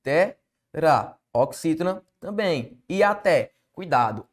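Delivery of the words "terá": "Terá" split as Te, pause, rá; "Terá" pulled apart with a gap before rá; separated syllables. Shown as a demonstration. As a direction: say "Terá" one syllable at a time.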